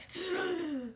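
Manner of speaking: high drawn-out gasp, acted out; re-enacted sobbing intake of breath; speechless crying gasp. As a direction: imitate gasping too hard to speak. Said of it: "I couldn't get a word out".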